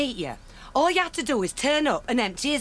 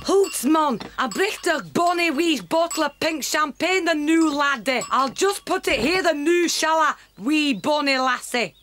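put-on Scottish accent. Delivering champagne in put-on Scots.

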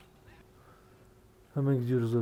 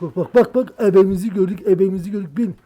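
loud, higher, rapid alarmed calls; excited shout; 6 semitones higher, 11 dB louder than usual. Real, not acted. Gamer about to crash, excited shout. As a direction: cry out as disaster nears.

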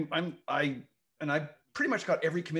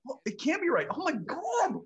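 much higher, mouth-barely-moving mock Aussie; Australian accent; 13 semitones higher, 4 dB louder than usual. Host trying the Cockney-with-closed-mouth trick, in a much higher mock Australian accent. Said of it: "that's pretty good".

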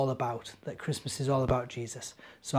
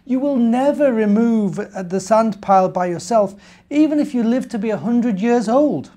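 lightly voiced bear, high and louder, pleading the point; earnest little bear; 9 semitones higher, 10 dB louder than usual. Little bear telling the ant she can't do it alone, earnest bear voice. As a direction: press it like a worried young bear.

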